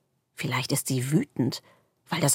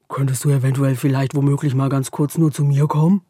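rushed mumble; 4 dB louder than usual. A narrator's reading. The darkness gabbles its shy request too fast. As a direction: rush all the words together shyly. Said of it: "spricht so schnell".

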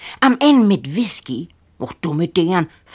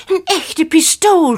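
higher, louder, slow excited shout; 10 semitones higher, 6 dB louder than usual. Acted. Boy shouting to his brother about the pistol, loud and slow. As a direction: blurt it out loud in wonder. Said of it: "schrei ich los".

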